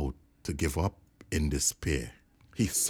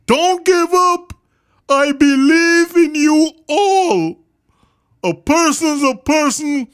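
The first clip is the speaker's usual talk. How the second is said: big high character voice, calling loudly; rally them with a loud, high earnest call; Horton calling out; 12 dB louder than usual. A narrator's reading. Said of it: "managed to call to the mare".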